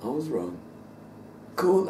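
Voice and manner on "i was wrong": low muttered confession; softly admitting he was wrong; quiet admission. Retold or imitated. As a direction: say it low and small, conceding. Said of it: "He just admitted".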